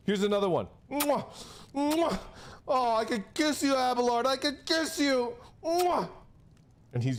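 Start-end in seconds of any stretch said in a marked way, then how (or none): 0.9-2.2 with a quick kiss. Retold or imitated. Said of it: "your kissing sounds". 2.7-5.3 high, playful mock swoon; gushing. Gushing at Abelard after kisses. 5.6-6.1 with a quick kiss. Retold or imitated. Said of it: "your kissing sounds".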